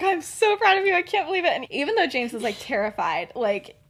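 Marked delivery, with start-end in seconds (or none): 0.0-1.5 milder gushing. Acted. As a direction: repeat the proud gush, toned down from a squeal. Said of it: "She's really going off here".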